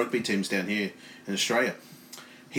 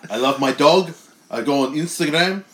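put-on louder Bulgarian accent promo; 3 semitones higher, 4 dB louder than usual.

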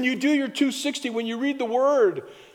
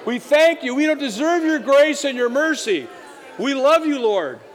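loud prayer; 5 semitones higher, 4 dB louder than usual. Acted. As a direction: lead the church in a loud, fervent prayer. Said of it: "Can we just say that?".

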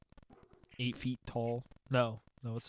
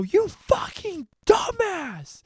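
high, loud, slow scolding shout; hammed-up furious holler; imitated angry yell; 5 dB louder, much slower than usual. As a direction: yell it like an exasperated brother. Acting out Tristan yelling at brother.

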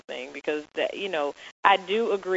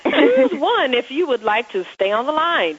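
high, loud automated phone menu voice; 8 semitones higher, 4 dB louder than usual. Retold or imitated. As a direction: read it out like a recorded phone menu. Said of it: "calling, like, you know, my cable company".

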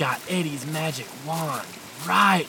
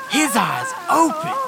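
rising excitement; 12 semitones higher, 5 dB louder than usual. Acted. Dramatic climax.

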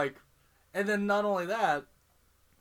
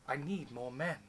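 put-on lightly British-accented officer; slight British cadence; 3 semitones lower, 7 dB quieter than usual. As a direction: ask with a slight British lilt. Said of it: "it's the slight British cadence".

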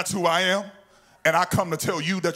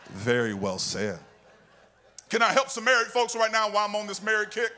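usual speaking voice

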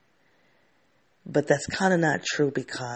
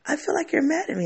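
much higher, small, anxious voice; imitating worried friend; 13 semitones higher, faster than usual. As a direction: mimic her timid question. Friend asks while driving back.

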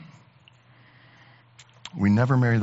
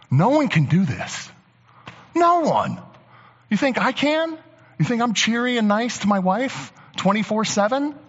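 much higher, pitched-up disbelief; incredulous outburst; 9 semitones higher than usual. Real, not acted. Pastor admitting his limits to the church, incredulous high. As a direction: throw the question up high in mock protest.

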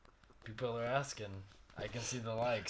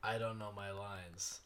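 quiet panicky text; 4 semitones lower, 10 dB quieter than usual. Retold or imitated.

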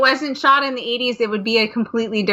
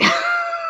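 high squeal laugh. Real, not acted. Husband's riot retold.

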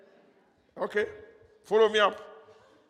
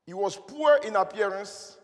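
preaching. Acted. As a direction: proclaim each phrase like scripture. Preaching the hidden sentence.

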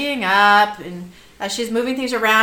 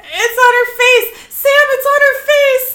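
high, loud rising freak-out; hammed-up panicked yelp; acted panic; 12 semitones higher, 8 dB louder than usual. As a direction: play escalating alarm, voice climbing. Sully panics over blood on face.

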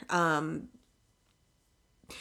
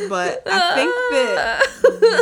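mock evaluator verdict; 5 semitones higher, 6 dB louder than usual.

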